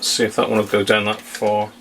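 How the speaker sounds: ordinary delivery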